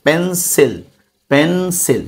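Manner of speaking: Pencil broken in two, পেন, সিল; split into syllables. Shown as a demonstration. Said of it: "দুইবার ভাগ করা গেছে". (Pencil দুই ভাগে ভেঙে উচ্চারণ)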